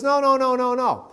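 high, emphatic mock protest from Sarah; insistent no. Wife overruling her husband, high insistent no. Sarah urging Abraham, retold.

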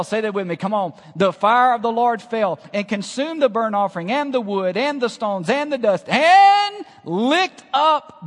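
loud, high, driving sermon delivery; rising fervor of a revival preacher; full-force preaching. Acted. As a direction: preach it out to the whole room.

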